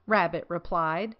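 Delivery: ordinary delivery, nothing marked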